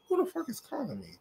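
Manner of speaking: high, quick, hushed irritation at an interruption; quick, quiet, high-pitched annoyed aside; startled annoyance. Real, not acted. Interrupted by an incoming phone call.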